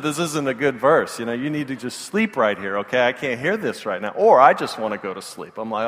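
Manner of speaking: normal talking voice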